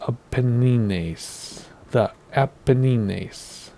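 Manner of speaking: "Apennines" slowly, ending "nee-nays"; Italian-style Apennines. Shown as a demonstration. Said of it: "Given it's Italy, it's probably".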